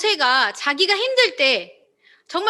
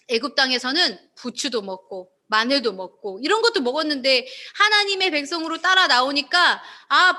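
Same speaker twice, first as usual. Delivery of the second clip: normal talking voice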